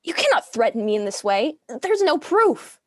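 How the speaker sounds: angry outburst. Acted. Widow leaping up to defy the accuser, angry loud cry. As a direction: fire back at him, voice climbing. Hanaka shoots to her feet.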